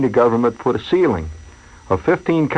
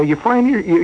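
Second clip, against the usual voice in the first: ordinary talk, nothing marked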